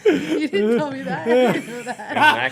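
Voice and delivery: laughing, slowly. Real, not acted. Laughing after dog story.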